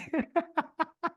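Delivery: light cackling laugh; cackle. Retold or imitated. Reality contestant laughing at castmates' stares, cackling. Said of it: "I was cackling".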